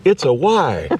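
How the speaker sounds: quoting a film line with a hint of character voice; movie line quote. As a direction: toss off a famous movie line. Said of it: "tell me what movie that came from".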